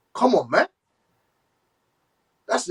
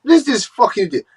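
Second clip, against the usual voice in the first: angry; 6 semitones higher than usual. Real, not acted. Furious rant at a receipt.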